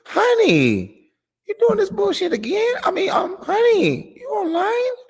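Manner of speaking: exaggerated high-pitched worried wife; mock the nagging wife, voice way up high; husband mimics his wife pestering him about being online; concerned wife. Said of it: "That was a concerned voice".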